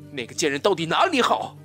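loud, jealous demand; jealous outburst. Acted. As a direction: rage about the rival. Jealous rant. (嫉妒地大声质问)

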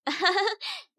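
high, light teasing giggle; a little mischievous chuckle; playful laugh. Acted. Kat laughs while teasing a scared little boy, playful giggle.

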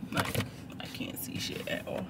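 voiceless annoyed whisper. Real, not acted. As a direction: mutter it in a whisper. Sun glaring, whispered repeat.

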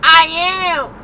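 high, loud retort; old lady yelling back. Retold or imitated. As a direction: snap back defensively. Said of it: "she was like".

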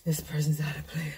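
hushed whisper. Acted. Reader whispers a secret card reading to viewers. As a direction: lean in and whisper the reveal.

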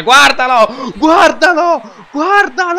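rising excited shouts; excited shouting. Real, not acted. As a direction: yell it, thrilled. Watching a great goal.

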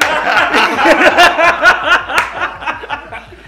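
loud burst of laughter. Real, not acted. Laughing hard at his own Spanish question. Asks when to speak Spanish.

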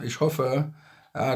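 ordinary talk

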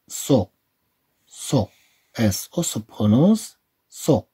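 slow syllable drill, "ce" said clearly; slow sound drill. Shown as a demonstration. Teacher drilling French syllables with pupils, slow. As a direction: model each syllable slowly.

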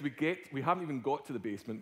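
ordinary delivery

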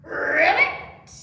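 loud rolled ribbit, rising; pig's frog croak. A narrator's reading. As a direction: croak it with a long trilled R. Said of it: "the little pig said".

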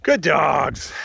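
high, loud praise for dogs. Real, not acted. Praising the dogs as they come, calling loudly.

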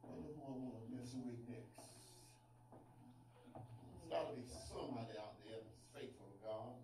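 low, sly voice of Satan, quiet and plotting; Satan scheming. Acted. Preacher voicing Satan roaming heaven, low scheming mutter. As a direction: drop low and scheme like the devil looking for prey. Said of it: "as Satan is going through heaven".

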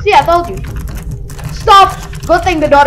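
loud, high frightened shouts; panicked yelling. Real, not acted. Panicking at threat in game.

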